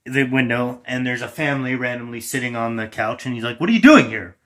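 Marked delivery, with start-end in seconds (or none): 3.6-4.4 loud demand. Acted. Intruder confronts family on couch.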